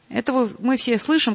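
normal talking voice

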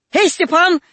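loud, high call across the yard; calling out loudly. A narrator's reading. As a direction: call to the deaf husband. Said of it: "позвала она глухого".

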